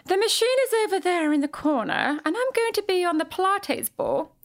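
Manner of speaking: high-pitched put-on Canadian voice; Linda Evangelista impression. Celebrity client directing the repair person, high Canadian voice. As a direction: sing out instructions in a high Canadian accent. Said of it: "in her voice, Canadian voice".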